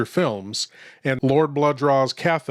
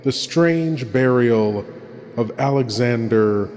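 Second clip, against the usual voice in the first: hushed horror host; 2 semitones lower than usual. Acted. Horror host introduces tonight's tale.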